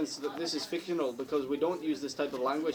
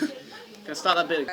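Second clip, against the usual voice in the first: laughing; 2 semitones higher, 4 dB louder than usual. Real, not acted. Still laughing asking to restart.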